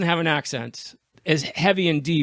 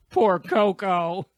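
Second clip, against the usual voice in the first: slow, higher, drawn-out mock lament; mock pity; 5 semitones higher, much slower than usual. Podcaster jokingly mourns a dropped sitcom character, mock-pitying. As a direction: draw it out in playful sympathy.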